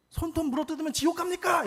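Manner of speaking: shouted high and loud at the father; defiant teenage yell in the middle of a church service; retold angry outburst. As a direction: blurt it out in a furious, high shout.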